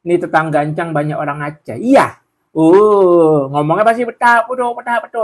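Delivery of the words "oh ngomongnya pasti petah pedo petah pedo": high, lilting Acehnese accent. Shown as a demonstration. Showing Encang how Acehnese neighbours talk, high Acehnese accent. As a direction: put on an Acehnese lilt. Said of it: "Kayak gitu ngomongnya kan".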